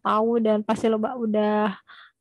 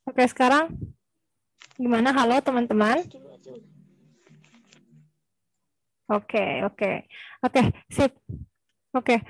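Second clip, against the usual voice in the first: corrupted glitchy audio. Real, not acted. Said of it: "Suaranya putus-putus".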